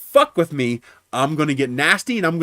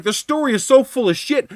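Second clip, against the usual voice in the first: high, loud and outraged; retold angry dismissal; 12 semitones higher, 5 dB louder than usual. Driver rejecting the client's story, pissed off. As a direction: blurt it out in indignation. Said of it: "I was pissed off to no end".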